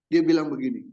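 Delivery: normal talking voice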